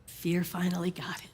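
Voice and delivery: soft whispered aside. Acted. Love and Faith whisper about Fear. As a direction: whisper it to each other knowingly.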